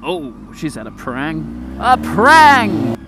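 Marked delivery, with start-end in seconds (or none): 1.8-3.0 high, loud mock exclamation. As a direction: shout the word prang up high with glee. Repeats it for effect.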